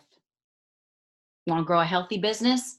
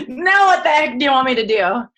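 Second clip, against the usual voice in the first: loud, higher, half-laughing exasperation; exasperated plea to God; 7 semitones higher, 8 dB louder than usual. Retold or imitated. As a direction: throw up your hands and ask the Lord. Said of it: "And now I'm, like, going".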